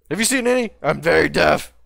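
shouting over his own deafness; deaf shouting. Real, not acted. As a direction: yell it like you can't hear yourself. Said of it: "I'm deaf".

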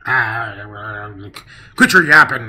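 put-on loud, cranky old-man bark; crotchety old man yelling; grumpy old man. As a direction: snap at them like an old grouch. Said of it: "See? I'm good at that".

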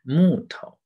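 slow 木头, strong 木 and light 头; neutral-tone demo. Shown as a demonstration. Teacher models slowly, 木头 with 头 in neutral tone. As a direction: punch and stretch 木, keep 头 unstressed. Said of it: "有三种neutral tone啊".

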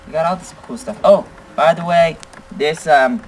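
ordinary delivery

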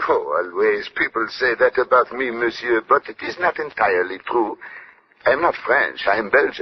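French-tinged English of a Belgian detective; courteous Belgian French accent. Acted.

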